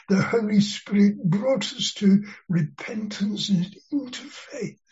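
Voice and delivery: ordinary talk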